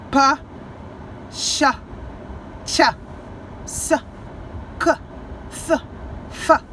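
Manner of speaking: P, CH, CH, S, K, S, F as bare puffs, spaced apart; isolated unvoiced sounds. Shown as a demonstration. Teacher demonstrating mouth-made sounds, p, ch, s, k, f without throat voice. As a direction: fire off "p, ch, s, k, f" separately, from the mouth. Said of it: "Esses são os sons".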